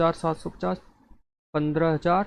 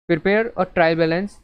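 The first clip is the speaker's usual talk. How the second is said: reading the question out very slowly, word by word; slow read-aloud; much slower than usual. Shown as a demonstration. Teacher reading the exam question to class very slowly. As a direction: spell out the problem for students.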